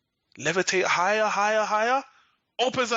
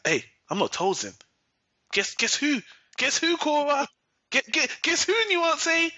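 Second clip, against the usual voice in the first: teasing guess who; 9 semitones higher than usual. Retold or imitated. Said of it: "you hear a voice. It's like".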